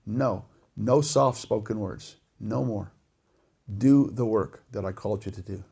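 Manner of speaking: low, measured, firm command; stern God. Acted. God refusing a prophet soft words, stern and low. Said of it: "There's times when God just says".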